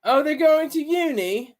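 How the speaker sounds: hammed-up English accent, rising at the end; terrible English accent. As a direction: put on a bad English accent. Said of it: "that's my terrible English accent".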